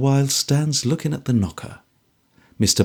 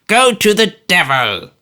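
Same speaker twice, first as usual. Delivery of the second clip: put-on higher, louder old man's curse; Smallweed repeating the curse louder; repeated curse; 7 semitones higher, 5 dB louder than usual. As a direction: hurl it again.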